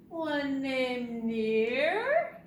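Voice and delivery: stretched, swooping up loud on Near; slow rising loud flourish; drawn-out rhyme. Pretend. Acting out rhyme word Near.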